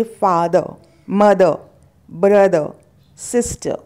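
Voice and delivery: फादर, मदर, ब्रदर, सिस्टर each without final R; silent R. Shown as a demonstration. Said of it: "आर का उच्चारण आप इन शब्दों". (आखिरी र silent)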